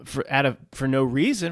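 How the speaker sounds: ordinary talk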